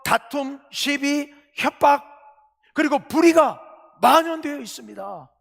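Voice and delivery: rising crescendo of outrage at injustice; preacher building to full force; fiery preaching. Acted. Listing evils, building intensity.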